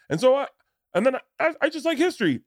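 very high-pitched contestant impression; dating show contestant. Contestant on Love Connection before the freeze-frame, mock contestant.

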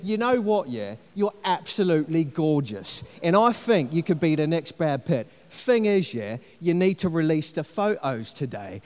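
a half-hearted Cockney patter; Cockney accent. Retold or imitated. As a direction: con man flattering a new mark in Cockney. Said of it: "the Cockney fella speak up".

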